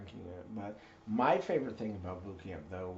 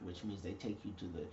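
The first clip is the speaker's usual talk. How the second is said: normal talking voice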